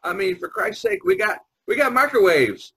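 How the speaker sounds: usual speaking voice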